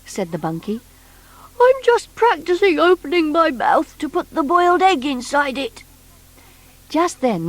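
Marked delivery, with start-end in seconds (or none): 1.6-5.8 high, funny little creature voice; bunkie's voice. Acted. Bunkie telling Noddy about himself, high creature voice. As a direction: squeak it out like a hurt half-rabbit.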